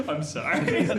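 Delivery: high, loud, slowed apology through real laughter; helpless laughter. Real, not acted. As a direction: apologise while still cracking up.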